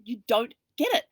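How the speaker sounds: to the ranting husband, giddy insistence; excited. Retold or imitated. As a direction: keep pressing with giddy energy. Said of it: "bouncing with excitement".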